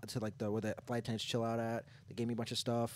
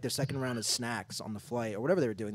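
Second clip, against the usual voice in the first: ordinary talk, nothing marked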